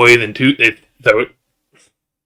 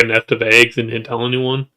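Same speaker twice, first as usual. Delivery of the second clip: ordinary talk, nothing marked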